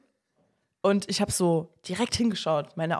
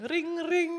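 phone ring imitation; 10 semitones higher than usual. Imitates God calling on phone.

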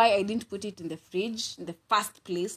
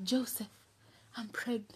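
soft confession; 10 dB quieter than usual. Retold or imitated. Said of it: "That is Mary".

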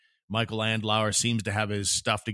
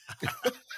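sarcastic laugh; 6 dB quieter than usual. Real, not acted. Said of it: "I'm just being sarcastic".

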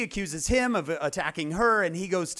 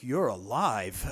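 slow, low, dread-filled realization; dawning dread; 5 semitones lower, much slower than usual. Retold or imitated. Brothers realizing Joseph could take revenge, slow dread. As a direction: let the fear sink in slowly. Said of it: "and then you'd be like".

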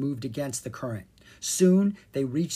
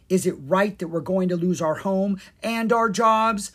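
upset, raised-pitch demand, building in force; 6 semitones higher, 5 dB louder than usual. A narrator's reading. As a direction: press the question, louder towards the end. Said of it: "Miranda demanded".

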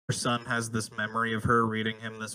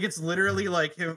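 normal talking voice

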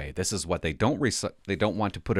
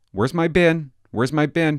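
higher and louder, insistent; calling for the bin; 6 semitones higher, 6 dB louder than usual. Real, not acted. Hunting for the recycling bin, loud and insistent. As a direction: demand the bin.

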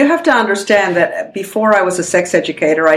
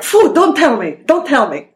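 higher-pitched mock brush-off; mother's dismissive refusal; 7 semitones higher than usual. Daughter quoting mother refusing talk of sex, mock dismissive recoil. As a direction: imitate a mom shutting the subject down. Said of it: "my mother was always like".

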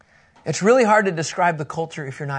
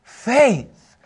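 slow, weighty rebuke of the disciples; Jesus rebuking; slower than usual. Retold or imitated. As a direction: draw it out like a patient but exasperated teacher. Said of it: "Jesus said in verse eight".

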